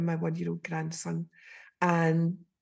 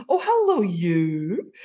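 effusive hello; 4 dB louder, slower than usual. Retold or imitated. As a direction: greet her like a long-lost friend. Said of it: "it was so effusive".

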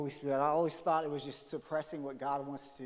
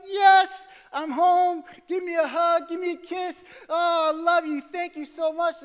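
much higher and louder gushing welcome; overjoyed dad; 13 semitones higher, 12 dB louder than usual. Retold or imitated. Dad greeting his daughter, high loud overjoyed. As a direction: beam it out to your little girl. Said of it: "I'm like".

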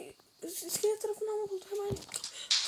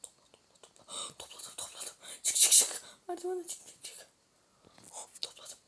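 rapid hushed whisper; urgent whisper; 7 dB quieter than usual. Real, not acted. Sneaking, hurrying whispers. (aceleyle fısıldayarak)

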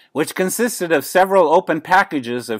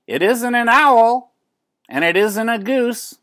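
squint at the bird and puzzle it out; puzzled neighbor lady; 10 semitones higher, 4 dB louder than usual. A narrator's reading. Neighbor lady staring at penguin.

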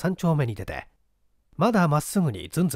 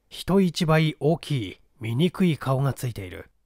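usual speaking voice

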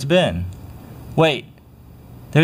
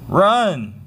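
usual speaking voice

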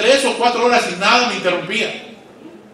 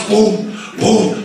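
ordinary delivery

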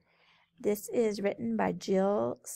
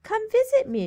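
high calling spider voice; spider crying out; 14 semitones higher, 6 dB louder than usual. Acted. Lonely spider calling out to visitors, high. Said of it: "she cried".

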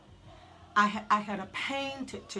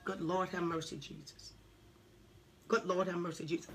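quiet prayer; 3 semitones lower, 7 dB quieter than usual. Real, not acted. Murmured prayer aside.

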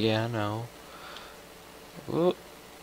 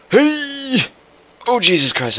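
high, loud yelp of alarm; startled shout mid-jump; alarmed yell; 10 dB louder than usual. Real, not acted. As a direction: cry out in sudden panic. Jumps off the building, alarmed.

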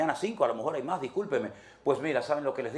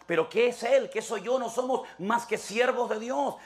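Paul angrily setting them straight; irritated Paul; 6 semitones higher than usual. Retold or imitated. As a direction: scold the quarrelling church. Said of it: "Pablo se enoja y dice".

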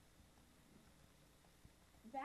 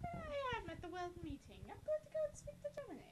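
higher, lightly put-on cartoon voice; mock character voice; 10 semitones higher, 3 dB louder than usual. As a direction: play the character in a silly high voice. Voicing game character mockingly.

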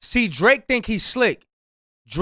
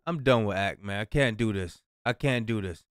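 much softer and lower, worn-out defeat; giving up; 9 semitones lower, 7 dB quieter than usual. Real, not acted. Reactor quitting on the skit, soft and lower. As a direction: let it drop like you're done.

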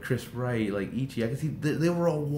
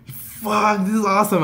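high, loud excited exclamation; thrilled outburst; 9 semitones higher, 6 dB louder than usual. Retold or imitated. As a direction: burst out with fan joy. Thrilled fan reliving the moment.

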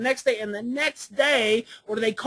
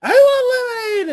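put-on toddler wail; 11 semitones higher, 8 dB louder than usual. Son in the truck demanding lemonade, high crying wail. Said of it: "regresses literally to about an infant".

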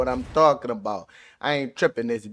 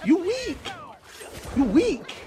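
much higher put-on jeering voice; high cartoonish taunt at the beaten player; squeaky mocking taunt; 13 semitones higher than usual. Real, not acted. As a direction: needle him in a silly high voice.

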